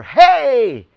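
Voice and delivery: loud, high shout of hey; a sudden bright yell for attention; calling hey. Shown as a demonstration. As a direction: call out to grab attention. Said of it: "a picture of a man doing this".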